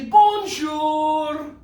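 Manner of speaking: put-on high, loud BONJOUR for kids to echo; big high call, drawn out; called-out greeting. Shown as a demonstration. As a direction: sing it out to a class. Leads kids calling out greeting.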